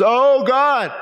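high, loud mock cry to God; demonstrative plea, pitched well up; vocal crying out. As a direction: cry out aloud as an example of impassioned prayer. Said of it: "doesn't even have to be vocals".

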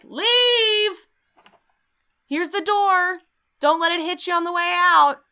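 high, loud mock ghost yelling; angry ghost. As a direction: shout like a fed-up ghost chasing them out. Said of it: "the ghost is like".

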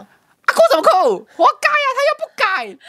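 high-pitched, loud mock scolding of a crying grandma; bratty little kid snapping at a grieving elder, loud and high; child scolding grandma. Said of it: "你知道我骂什么吗？ 劈头大骂".

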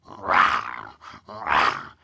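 low growling wolf rah. Acted.

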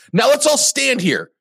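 very high, slow, grandiose mock proclamation; mock grand announcer. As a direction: proclaim it slowly like a pompous leader. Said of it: "At the end of your speech".

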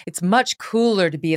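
normal talking voice

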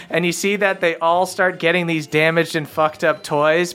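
normal talking voice

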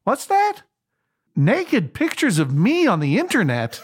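high startled question, then drawn-out disbelief; shocked disbelief. Retold or imitated. Train passenger hearing shocking news on his phone, startled disbelief. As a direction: imitate a cheery caller hit by bad news.

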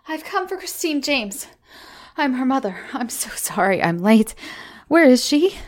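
high, breathless panicked mother; gasped, frantic. Acted. Mother at the school, frantic for her lost daughter. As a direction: ask fast, scared for the child. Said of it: "I gasped".

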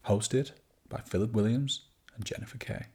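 hushed, breath-heavy radio presenter; smooth, whispery podcast introduction; Smooth FM style. Acted. Co-host welcoming listeners to the podcast, hushed Smooth FM style. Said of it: "do it Smooth F M style".

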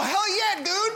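high excited hell yeah; excited cheer. Acted. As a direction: cheer it like a hyped fan. Hyped fan cheer.

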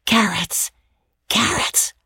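sharp teasing whisper, rising; taunting schoolboy whisper; piercing whisper. A narrator's reading. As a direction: hiss it across the aisle to tease. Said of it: "said in a piercing whisper".

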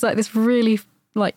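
ordinary delivery

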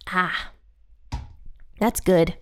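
satisfied sigh after coffee; a refreshed ah after sipping; after a sip. Real, not acted. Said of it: "take a sip of my coffee".

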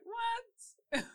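very high squeaky shocked what; startled what. Retold or imitated. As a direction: imitate his shocked squeal. Imitating husband, far high cry.

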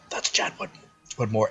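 hushed whisper among workers; whispered aside. Retold or imitated. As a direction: whisper it so the boss won't hear. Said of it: "It's like".

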